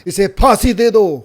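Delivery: loud, high-pitched call for execution; outraged cry. Acted. Someone in the crowd demanding a hanging, loud outraged cry. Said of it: "कोई कहता है".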